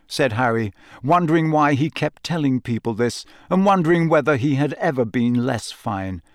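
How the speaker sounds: usual speaking voice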